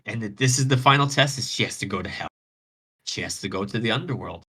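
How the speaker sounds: plain reading voice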